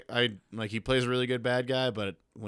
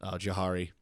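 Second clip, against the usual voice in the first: usual speaking voice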